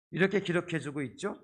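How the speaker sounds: ordinary talk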